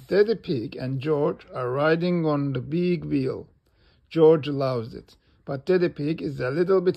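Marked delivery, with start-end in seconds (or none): none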